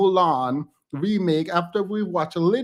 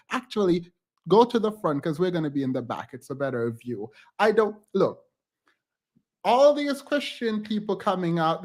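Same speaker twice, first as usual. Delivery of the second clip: ordinary talk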